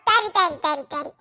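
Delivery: normal talking voice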